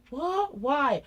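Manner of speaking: high, loud imitation of indignant kids; mock disbelief. Mum imitating children told Wi-Fi is switched off, loud disbelief. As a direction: cry it out like a child told the bad news. Children's outraged reaction, retold.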